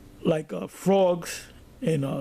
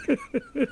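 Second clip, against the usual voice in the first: high-pitched laugh. Real, not acted. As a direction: laugh high at your own boast. Laughs after boasting about himself.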